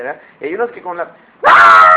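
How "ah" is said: loud hammed-up wail of a kid; child's scream. Preacher playing a child dreading a spanking, mock scream. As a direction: let out a big mock howl as the kid.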